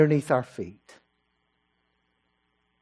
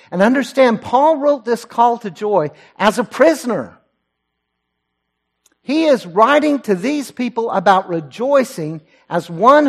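higher, louder preaching that builds and presses; full-force preaching; 5 semitones higher, 5 dB louder than usual. Acted. Preacher urging the congregation to hold on to joy, rising and insistent. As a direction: preach it out to the whole church, urging.

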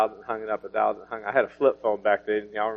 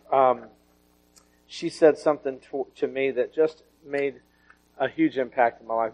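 usual speaking voice

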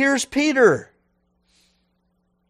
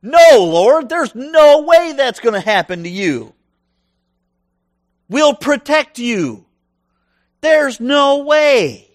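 louder, insistent refusal; loud protest; 4 semitones higher, 5 dB louder, slower than usual. Acted. Peter stands toe to toe with Jesus, loud refusal. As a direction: plant your feet and refuse him.

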